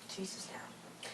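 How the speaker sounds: breathy, slow, barely voiced; whispered under the breath, slowly; hushed aside. Real, not acted. Viewer reacting to the sleeping girl's threat, hushed.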